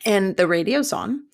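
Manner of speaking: normal talking voice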